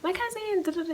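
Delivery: high excited gushing; excited squeal. Retold or imitated. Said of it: "Anyway, I was like".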